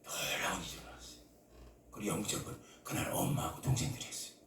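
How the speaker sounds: whispered, dropping right down; hushed whisper. Real, not acted. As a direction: breathe the words as a secret. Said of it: "hushed whisper after climax".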